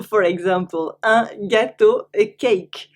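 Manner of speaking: usual speaking voice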